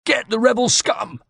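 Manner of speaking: put-on disguised as a Genoese soldier, calling out in his accent. A narrator's reading. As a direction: yell it like a Genoese soldier hunting rebels. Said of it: "in what I hoped was an approximation of the voice and accent of a Genoese soldier".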